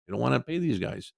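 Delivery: ordinary delivery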